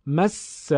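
"مس" with a long double s; emphatic held consonant. Shown as a demonstration. Teacher summing up the rule, emphatic held س in "مس". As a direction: hold the س in "مس" firmly, then release. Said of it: "حرف مشدد با تأکید و کمی مکس ادا".

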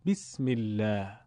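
"بسم الله" slowly with a clear i, bismillah; slow, careful Arabic-style bism; Arabic kasra. Shown as a demonstration. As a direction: lean the vowel of بسم to ee. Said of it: "کسره میل به ای".

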